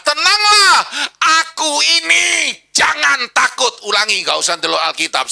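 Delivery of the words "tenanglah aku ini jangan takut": high, loud proclamation; commanding call. Acted. Jesus calls to frightened disciples, loud and commanding. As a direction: call it out boldly over the storm. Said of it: "Lima kata".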